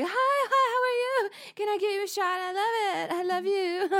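high, gushing flirty voice; swooning women. Retold or imitated. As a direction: gush at him like a smitten fan. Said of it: "all the women were just like".

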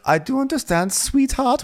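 high, sugary condescension; patronizing. Retold or imitated. Cohost mocking Diana's patronizing reply, high and sugary. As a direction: talk down to her sweetly. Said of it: "which is so patronizing".

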